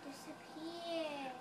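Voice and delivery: mysterious magician. Pretend. Magician announcing vanishing trick.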